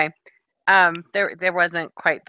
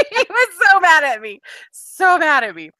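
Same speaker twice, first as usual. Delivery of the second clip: gleeful exclaim; 12 semitones higher, 9 dB louder than usual. Real, not acted. Laughing recollection, repeated phrase.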